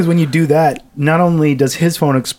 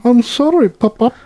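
put-on whiny child; 11 semitones higher than usual. Mocking child apology bit.

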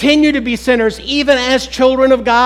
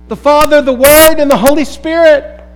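louder, higher proclaiming of each name; emphatic list; 5 semitones higher, 7 dB louder than usual. Real, not acted. Preacher proclaiming the Trinity to the congregation, emphatic. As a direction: ring out each person of the Trinity.

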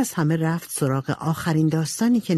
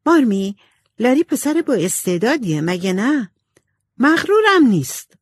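Amy voice; 7 semitones higher, 4 dB louder than usual. A narrator's reading. Amy asks Marmee about Laurie.

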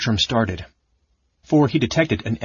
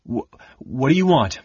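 frightened stammer; 4 semitones higher than usual. Acted. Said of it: "he stammered".

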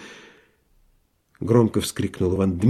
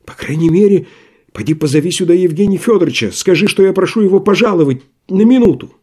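возбуждённая просьба; 6 semitones higher, faster than usual. Acted. Рагин просит возбуждённо.

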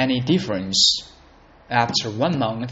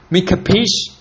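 higher, louder mobster question; tough gangster; 6 semitones higher, 5 dB louder than usual. Retold or imitated. Movie gangster warning a listener, higher and louder. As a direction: say it with mobster menace. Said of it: "that kind of feeling".

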